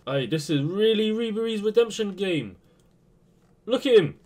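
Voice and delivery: ordinary talk, nothing marked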